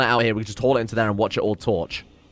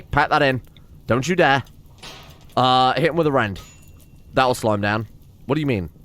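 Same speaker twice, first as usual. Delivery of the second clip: usual speaking voice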